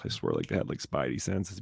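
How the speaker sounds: normal talking voice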